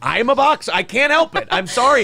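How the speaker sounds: high, whiny mock apology. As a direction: squeak out a fake sorry.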